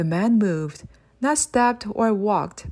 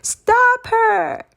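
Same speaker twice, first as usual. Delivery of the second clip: much higher, much louder shriek; full-blown scream; 7 dB louder than usual. Acted. Mister Creeper shrieking after Amelia a second time, full scream. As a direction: scream it with every ounce. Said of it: "with even more exclamation mark".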